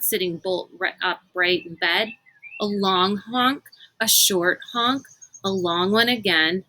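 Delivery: ordinary delivery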